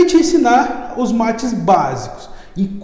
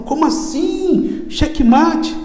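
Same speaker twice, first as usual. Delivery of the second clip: "Checkmate?" high, slow and disbelieving; a stunned king, higher and slow, can't believe it; astonished king; 8 semitones higher, much slower than usual. Retold or imitated. King gaping at the shepherd who just mated him, higher and incredulous. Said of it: "arregalou um olho enorme".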